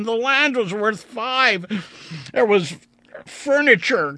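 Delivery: high, loud anguished lament over lost wealth; weeping despair. A narrator's reading. Vandeleur weeping as he counts his money, high anguished wail. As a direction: wail it out, near tears. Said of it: "Vandeleur wept".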